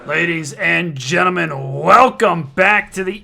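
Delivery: booming online-league broadcast intro; announcer welcome. Acted. Host opens the broadcast.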